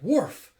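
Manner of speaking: high, loud spoken woof; mock dog bark. Imitates the dog's bark.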